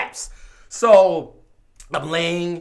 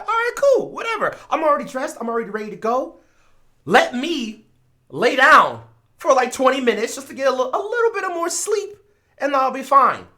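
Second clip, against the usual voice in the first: much higher, airy carefree voice; breezy mock-nonchalant; 7 semitones higher than usual. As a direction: toss it off like nothing matters. Retold own carefree reply.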